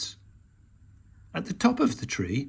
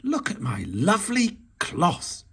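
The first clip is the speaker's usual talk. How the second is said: cried out; 5 semitones higher than usual. A narrator's reading. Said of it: "he cried".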